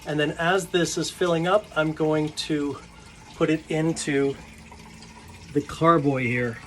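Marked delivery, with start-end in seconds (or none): none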